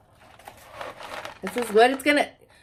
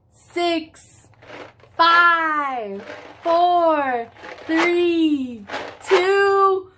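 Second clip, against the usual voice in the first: countdown building louder and higher; new year countdown crowd; 4 semitones higher, 3 dB louder, much slower than usual. Acted. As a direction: count down, rising with excitement.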